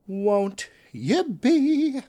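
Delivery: high, very slow Southern drawl; Southern gentleman accent. Acted. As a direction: stretch it out like a Southern gent. Drawn-out Southern voice.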